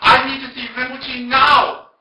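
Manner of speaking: high, drawn-out indignant yell; very loud, high-pitched retold scream, stretched out; screaming demand. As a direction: imitate a woman screaming a demand. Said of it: "she was screaming at me".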